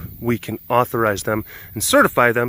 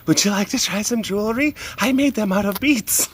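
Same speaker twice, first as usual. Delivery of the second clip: high, sing-song sales pitch; eager vendor; 7 semitones higher than usual. Pretend.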